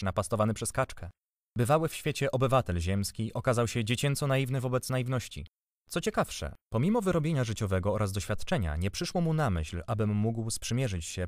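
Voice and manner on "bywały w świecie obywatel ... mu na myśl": ordinary delivery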